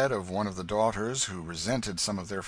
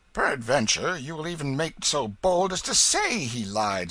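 loud, higher, scornful challenge; scoffing; 7 semitones higher, 6 dB louder than usual. A narrator's reading. Hugo daring the King to call his father liar, scoffing. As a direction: scoff at such boldness. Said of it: "scoffed Hugo".

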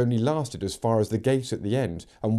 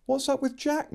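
put-on worried girl's voice. Megan worried about horse.

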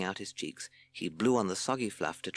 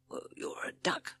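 slow, soft snake hiss; Hissing Sid; 4 dB quieter than usual. A narrator's reading. Snake answering his new companion, slow hiss. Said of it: "hissed Sid".

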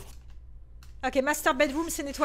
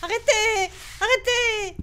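scared pleading; 6 semitones higher, slower than usual. Real, not acted. Begging the monster to stop.

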